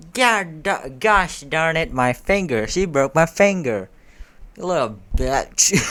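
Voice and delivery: loud, high pained outburst; hammed-up yelps of pain and anger; cursing in pain. A narrator's reading. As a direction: curse as if your finger just snapped. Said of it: "cursing in pain".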